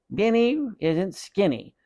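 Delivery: high teasing puppet voice; boy mocking his brother through the dummy. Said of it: "he made the dummy say".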